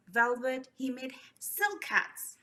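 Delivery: plain reading voice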